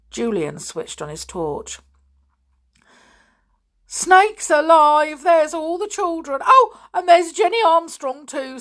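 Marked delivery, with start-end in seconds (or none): 3.9-8.6 great amazement. A narrator's reading. Mister Stick spotting all the children, amazed. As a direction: marvel in utter disbelief. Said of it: "in a tone of the greatest amazement".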